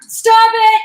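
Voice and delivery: high, loud command; peremptory shout. Acted. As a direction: shout it as an order that allows no argument. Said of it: "exclaimed peremptorily".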